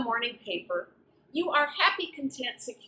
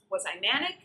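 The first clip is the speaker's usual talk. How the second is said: ordinary talk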